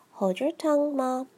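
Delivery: usual speaking voice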